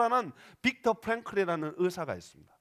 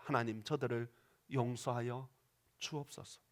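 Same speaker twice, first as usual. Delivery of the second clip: softer, lower, tender plea; gentle prayer; 9 semitones lower, 9 dB quieter than usual. Acted. Stephen praying for those stoning him, soft tender plea. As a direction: pray quietly for your killers' forgiveness.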